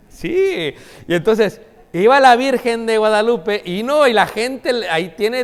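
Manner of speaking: high and loud burst of amusement; excited preaching burst; excited outburst. Real, not acted. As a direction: jump up in pitch like remembering a mischief.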